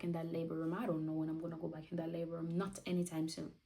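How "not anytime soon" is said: ordinary talk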